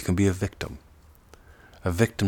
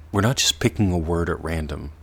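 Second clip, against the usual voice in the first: normal talking voice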